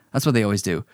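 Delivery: normal talking voice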